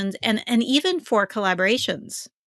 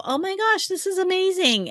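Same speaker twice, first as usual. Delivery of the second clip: gushing delight; 11 semitones higher than usual. Retold or imitated. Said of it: "I go".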